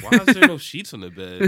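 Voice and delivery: confused outburst. Retold or imitated. Waking confused in her bed.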